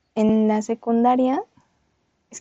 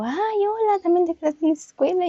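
retold greeting, pitched higher and bright; imitating the friendly boy; 8 semitones higher than usual. Retelling the boy who greets her on the way, higher and friendly. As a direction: play the boy chatting her up, sweet and upbeat.

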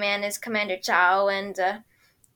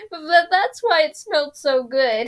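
mock whine; 5 semitones higher, 5 dB louder than usual. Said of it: "Sokka's like".

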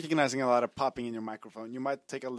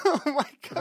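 real laughter through the words, high and loud; laughing; 14 semitones higher, 7 dB louder than usual. Real, not acted. Listener cracking up at a grim story, laughing. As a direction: blurt it out through helpless laughter.

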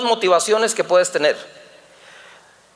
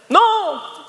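high wailed refusal, imitated; mock crying child; 9 semitones higher than usual. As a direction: protest like a little boy in tears. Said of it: "estaba llorando".

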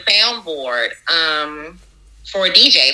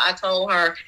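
normal talking voice

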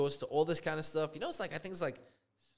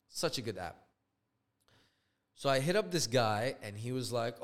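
ordinary talk

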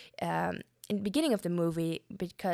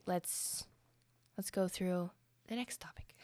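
soft whispered aside, almost no voice; 8 dB quieter than usual. Real, not acted. After ranting about Toby, whispers to get back on track. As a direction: drop to a whisper to move on.

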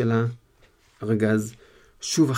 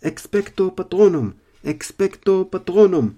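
roaring spell; 7 semitones higher, 7 dB louder than usual. Acted. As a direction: bellow the spell, dragging it out. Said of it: "שאג הארי".

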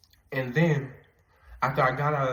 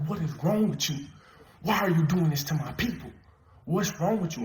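raised, higher pitched demand, retold in anger; furious retold challenge to the master demon; angry confrontation; 5 semitones higher than usual. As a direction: yell it at him in a retold rage. Confronting Satan, grabbing him.

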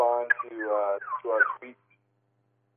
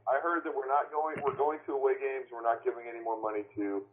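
ordinary delivery

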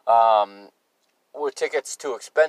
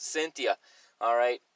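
ordinary delivery

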